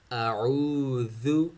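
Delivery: slow अऊज़ु, a stop on अ then an open ain; hamza to ain. Shown as a demonstration. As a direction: glide अऊज़ु from stop to throat. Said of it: "देखो".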